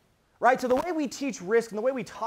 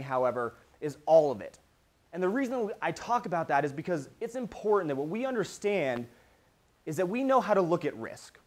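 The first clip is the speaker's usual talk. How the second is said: usual speaking voice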